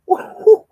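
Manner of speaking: sudden high loud laugh; loud burst of laughter. Real, not acted. Storyteller cracking up at his mom's line, loud laugh.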